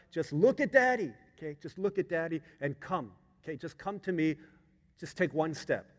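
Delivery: retold dad talking a child across; urgent encouragement. Dad urging daughter across.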